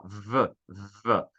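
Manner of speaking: a buzzing, drawn-out "v" released briefly; "v" as an isolated, humming consonant; voiced V sound. Shown as a demonstration. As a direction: hold the "v" so the throat vibrates. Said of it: "se eu falo".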